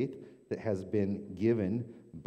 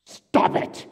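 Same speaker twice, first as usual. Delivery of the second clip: sudden loud shout, a sharp yell; shouted stop; 14 dB louder than usual. Retold or imitated. Newhart's therapist shouting at his patient, loud yell. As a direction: blast it out to cut her off. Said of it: "Newhart sketch, therapist snaps".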